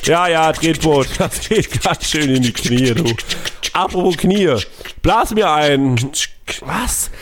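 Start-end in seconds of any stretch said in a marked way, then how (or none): 0.0-3.0 playing Bernd. Spielt Bernd.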